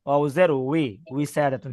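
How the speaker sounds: teacher's model of উই starting উইসাদাতুন; waw with zer. Shown as a demonstration. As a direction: spell out "wi", then say উইসাদাতুন. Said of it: "এটা হচ্ছে ওয়াও".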